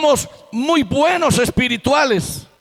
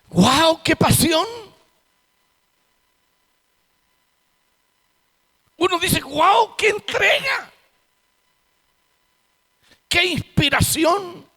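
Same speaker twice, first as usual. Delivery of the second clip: high, excited exclamations of wonder; awed admiration; 8 semitones higher than usual. Retold or imitated. Churchgoer marvelling at a fervent prayer, high and excited. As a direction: gush like an amazed onlooker.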